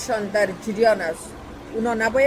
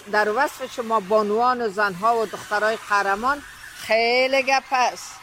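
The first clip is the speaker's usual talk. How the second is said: usual speaking voice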